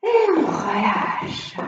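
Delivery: slow, low, soothing release; slow calming cue. Real, not acted. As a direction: ease them into letting go. Instructor cues relaxation slowly.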